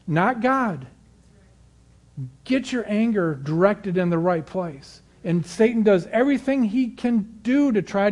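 fervent preaching, still pitched high. Acted. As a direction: keep urging the listeners from the pulpit.

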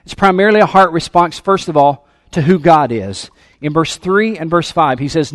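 loud, driving preacher's voice; fervent sermon delivery; preaching at full force. Acted. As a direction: preach it out to the church. Preaching to congregation.

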